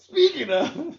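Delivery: sudden high, loud show-host voice; segue announcer voice. Pretend. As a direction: announce the topic change like a TV host. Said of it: "your other fucking famous segue tactics".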